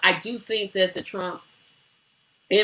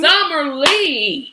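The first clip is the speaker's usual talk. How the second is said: hype announce; 5 semitones higher, 5 dB louder, much slower than usual. Real, not acted. Hyping up a guest.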